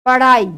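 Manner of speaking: पढ़ाई with a flapped ढ़, tongue striking then flipping; पढ़ाई with a clear flap; flap demo. Shown as a demonstration. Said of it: "इसका उच्चारण भी ड़ा जैसा हो रहा है".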